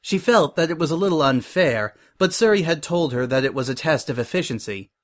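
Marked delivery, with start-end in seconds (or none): none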